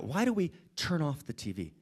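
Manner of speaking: ordinary talk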